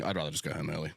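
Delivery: usual speaking voice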